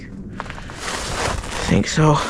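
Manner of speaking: very slow, soft whisper trailing off; dismayed whisper. Real, not acted. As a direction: breathe it out, barely voiced. Dismayed about bent frame.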